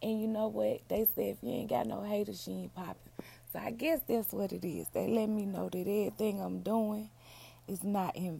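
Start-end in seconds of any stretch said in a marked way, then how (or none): none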